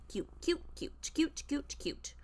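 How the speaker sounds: cooing, fading into a whisper. Real, not acted. Cooing at cat.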